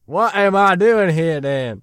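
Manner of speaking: slow, loud indignant drawl; loud exasperated Texan. Acted. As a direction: stretch it out, loud and put out.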